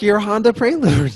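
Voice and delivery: high, loud mocking quote; hammed-up loud mimicry; mocking echo. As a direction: throw the car's name back incredulously. Mockingly echoes the teller's car.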